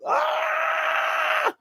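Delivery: a sudden high, strained scream; retold scream. Choking man forcing out a scream to breathe, high strained scream. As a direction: scream out to force the blocked airway open. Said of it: "I decided to scream".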